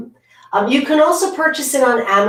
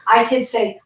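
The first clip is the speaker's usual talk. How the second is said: ordinary talk, nothing marked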